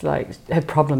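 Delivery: ordinary talk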